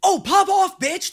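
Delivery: high, loud hype shout; thrilled, impressed outburst; excited cheering. Real, not acted. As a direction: cheer them on at the top of your voice. Cheering on a character's clever move.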